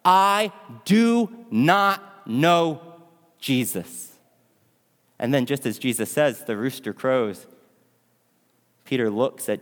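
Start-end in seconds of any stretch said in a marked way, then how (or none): 0.0-4.1 very slow, word-by-word mock yell, dropping on the last word; frustrated yell. Frustrated Peter yells his last denial, drawn out word by word. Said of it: "frustrated as he yells out".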